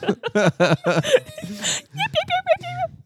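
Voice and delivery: high, squeaky imitation of a little dog; mock Shih Tzu yapping. Playing Irma the typist dog, high mock yapping. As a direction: yip like a tiny dog. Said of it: "Irma the Shih Tzu".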